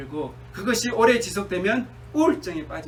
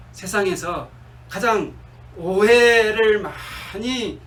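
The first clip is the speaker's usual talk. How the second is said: normal talking voice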